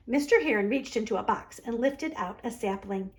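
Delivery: usual speaking voice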